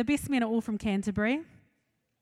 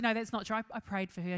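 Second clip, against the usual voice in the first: ordinary delivery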